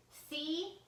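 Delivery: sharp yell at the screen. A narrator's reading. Villain yelling at his stubborn computer, loud. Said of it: "Doctor Reaper yelled at the computer screen".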